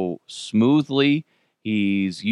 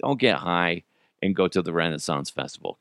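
usual speaking voice

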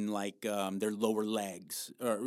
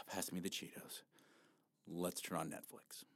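much softer, unvoiced mock mumble of giving up; defeated mumble; 8 semitones lower, 16 dB quieter than usual. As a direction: sigh and mutter it like you've quit. The quitting dieter slumps.

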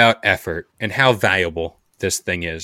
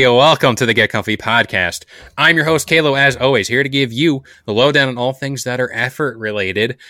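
ordinary talk

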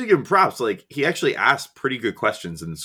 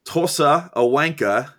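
put-on British accent, higher, slow; 6 semitones higher, slower than usual. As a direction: list the insults in a British accent. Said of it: "should I try to do it in a British accent?".

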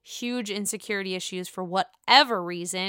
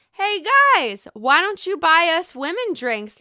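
higher, louder mock Melissa; imitating Melissa; 7 semitones higher, 6 dB louder than usual. Melissa asking the men for drinks, mock voice. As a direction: play her breezy demand. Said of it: "Melissa comes in and says".

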